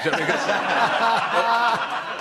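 put-on botched, drawn-out Geordie accent. Imitating the manager trying Geordie in interviews, botched Geordie accent. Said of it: "trying to do the Geordie accent".

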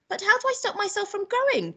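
put-on high-pitched little boy question. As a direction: ask it as a puzzled child. Boy asking grandma.

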